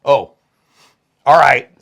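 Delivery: normal talking voice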